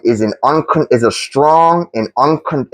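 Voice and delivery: normal talking voice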